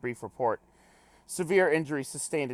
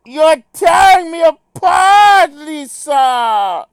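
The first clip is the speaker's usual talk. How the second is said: screaming, high and slow; anguished shout; 18 dB louder, much slower than usual. Retold or imitated. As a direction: howl it, crying out in despair. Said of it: "Cryptobippo says".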